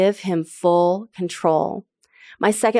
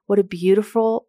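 ordinary delivery

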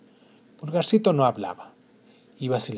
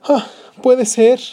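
sobbing; 10 semitones higher, 10 dB louder, slower than usual. Acted.

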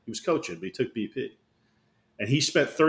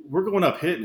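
usual speaking voice

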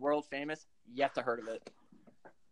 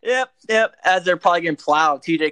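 excited; 12 dB louder than usual. Real, not acted. Eager to tell the punchline.

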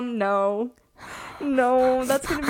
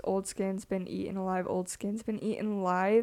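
monotone voice; 3 semitones lower, 7 dB quieter than usual. Acted. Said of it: "in a monotone voice".

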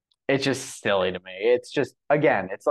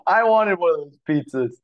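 laughing, higher and louder, craving the pizza; half-laugh it like you really want a slice; amused craving for the wasted pizzas; laughing longing; 9 semitones higher, 4 dB louder than usual. Real, not acted.

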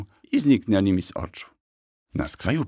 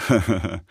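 short character chuckle. Acted. Kusy offering the legend without haggling, amused chuckle. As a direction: chuckle at your own joke. Horse jokes about bargaining.

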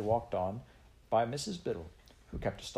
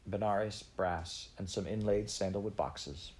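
ordinary delivery, nothing marked

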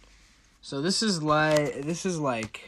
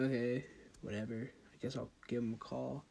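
indifferent shrug; 4 semitones lower, 14 dB quieter, faster than usual. Retold or imitated. Telling listeners about a recruiting call, indifferent soft mutter. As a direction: mutter it low and soft, couldn't care less.

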